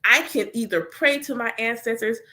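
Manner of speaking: ordinary delivery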